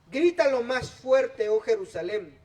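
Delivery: full-force preacher's cry; booming pulpit reading; loud scripture preaching. Acted.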